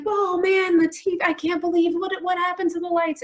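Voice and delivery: high-pitched mock outrage; furious husband. Husband upset that the lights went off again, mock furious. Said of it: "he was furious".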